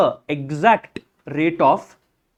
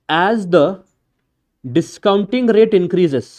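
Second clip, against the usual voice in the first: measured, spaced-out note-taking pace; slow dictation; much slower than usual. Real, not acted. Teacher dictates a note for students to write on the page, slow dictation. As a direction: dictate slowly with long pauses.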